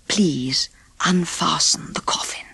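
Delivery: soft, hushed whisper; a grieving prince asking the dwarfs quietly. Acted. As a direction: whisper gently beside the coffin. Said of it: "the prince asked quietly".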